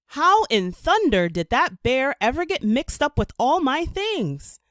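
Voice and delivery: high, loud puzzled exclamation; baffled at a bear in his laundry; bewildered. A narrator's reading. The artist finding a bear among his clothes, loudly puzzled. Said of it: "the artist wondered".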